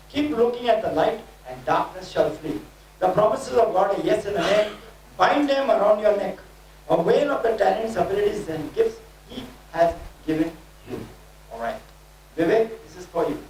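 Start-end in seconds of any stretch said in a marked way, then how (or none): 0.1-11.0 rhythmic declaring, each phrase pushed out; prophetic preaching. Acted. Preacher declaring prophecy over the congregation, fervent. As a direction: proclaim it like a prophet over the crowd.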